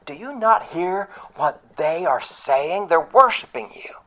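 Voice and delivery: a lightly voiced indignant Pharisee; indignant leaders. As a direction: leaders stop Jesus, scandalized. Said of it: "And they go".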